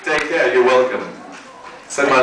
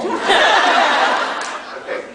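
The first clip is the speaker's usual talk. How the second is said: mock high okay. Mock answer on phone.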